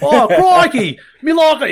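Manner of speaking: laughing, high put-on British accent; mock British accent. Host mocking his Australian friend in a British accent. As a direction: ham up a silly British voice through a laugh. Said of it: "I was doing the British one".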